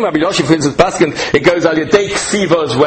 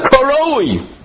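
"koroi" stretched out high and slow; emphatic, long, high-pitched "koroi"; stress on koroi; 9 semitones higher than usual. Real, not acted. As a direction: punch "koroi" high, drawing out the vowels. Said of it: "I always stress the word koroi".

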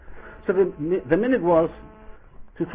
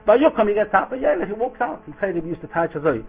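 ordinary talk

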